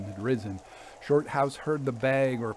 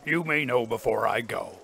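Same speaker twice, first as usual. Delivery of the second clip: understated gruff reply; a touch of suppressed anger. A narrator's reading. Father puts off his son's question, gruffly. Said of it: "returned the other gruffly".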